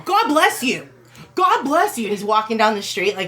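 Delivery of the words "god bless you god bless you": high-pitched hammed-up yell; mock yell. Preacher calling after a woman who refused him, mock yelling. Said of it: "he, like, started yelling".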